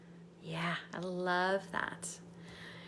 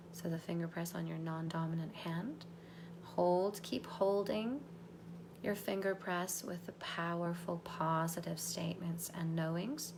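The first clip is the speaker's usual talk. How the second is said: soft, low, whispery meditation voice; guided meditation; 3 semitones lower, 4 dB quieter than usual. Acted. Coach guiding a group through anchoring, soft meditation voice. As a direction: guide them inward gently, almost whispering.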